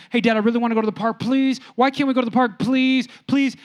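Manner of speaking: put-on high, fast pleading child; begging kid. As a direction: imitate a son pestering dad with pleas. Voices son begging for the park.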